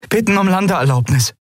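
high, eager call. Acted. Endriel eagerly asks Suran for landing permission. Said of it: "rief Endriel".

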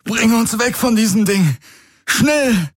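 high, strained, urgent gasp; gasped order. A narrator's reading. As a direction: gasp out a desperate order to flee. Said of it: "keuchte Garlin". (keuchend, dringend befohlen)

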